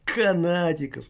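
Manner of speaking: sneering cackle through the words; mock him with a spiteful laugh; malicious mocking laugh. A narrator's reading. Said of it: "злобно расхохотала".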